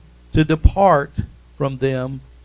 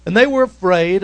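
normal talking voice

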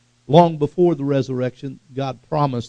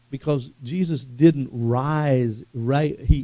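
usual speaking voice

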